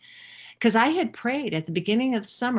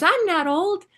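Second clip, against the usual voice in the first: put-on much higher, louder child's protest; indignant child; 12 semitones higher, 6 dB louder than usual. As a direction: squeak it like an offended little girl. Granddaughter protests being called old.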